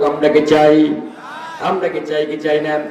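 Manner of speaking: usual speaking voice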